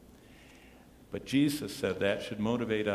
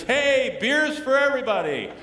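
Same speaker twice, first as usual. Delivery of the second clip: mock waitress holler; 12 semitones higher, 10 dB louder than usual. Waitress to the whole birthday table, imitated holler. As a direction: call out much higher and louder, hammed up.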